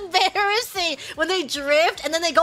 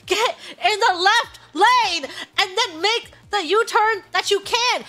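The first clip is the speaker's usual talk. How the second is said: slow, spelled-out directions, pitched up; strained patient instruction; 4 semitones higher than usual. Retold or imitated. Daughter guiding her lost driver mom, slowly and pointedly.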